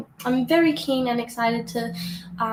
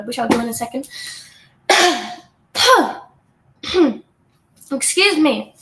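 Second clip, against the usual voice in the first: ordinary talk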